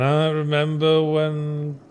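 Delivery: slow, wistful reminiscing survivor. Acted. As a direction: reminisce slowly as the last one left. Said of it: "[name] seems, like, destined".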